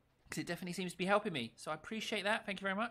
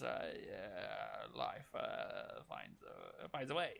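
hushed, halting, low impression; Jeff Goldblum; 7 semitones lower, 10 dB quieter, much slower than usual. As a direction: murmur it softly with stammering hesitations. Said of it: "I feel like doing Jeff Goldblum impressions".

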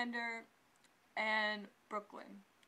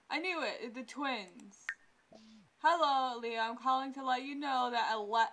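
ordinary talk, nothing marked

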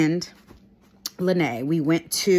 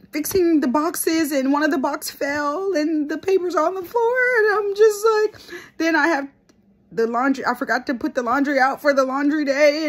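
frantic venting; 9 semitones higher than usual. Retold or imitated. Frantic overwhelmed retelling.

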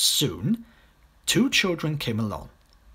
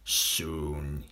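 crocodile thinking; 10 semitones lower, 6 dB quieter than usual. A narrator's reading. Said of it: "he thought".

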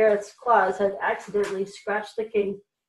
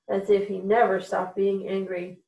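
ordinary delivery, nothing marked

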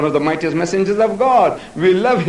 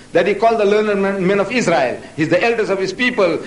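ordinary delivery

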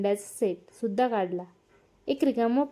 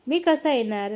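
ordinary delivery

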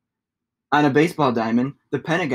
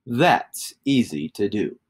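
lower, slow and knowing; deep shapeshifter; 5 semitones lower, slower than usual. Acted. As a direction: grant the wish calmly like a wizard. Shapeshifter voice low.

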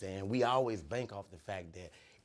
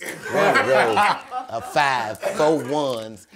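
laughing; 6 semitones higher, 13 dB louder, much slower than usual. Retold or imitated. Unc voiced at register, laughing.

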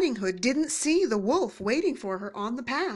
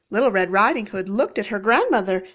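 plain reading voice

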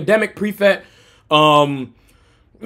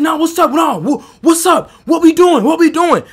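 confrontational challenge; 5 semitones higher than usual. Retold or imitated. Acted challenge to a fight.

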